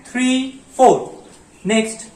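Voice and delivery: ordinary talk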